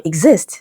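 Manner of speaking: "exist" stressed on IST; second-syllable stress. Shown as a demonstration. Teacher giving another root word, second-syllable stress. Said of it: "second syllable".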